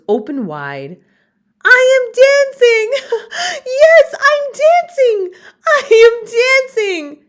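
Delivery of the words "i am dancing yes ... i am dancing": very high, loud, laughing cry of joy; joyful cry. Acted. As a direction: shout it out in pure delight. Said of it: "Gerald cried".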